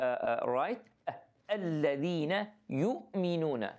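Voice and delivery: usual speaking voice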